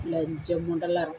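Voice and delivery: low, slow, spat-out curse; low contemptuous curse. Real, not acted. Vlogger curses her online critics, low and slow. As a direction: drag the insult out low and slow. Cursing commenters in rant.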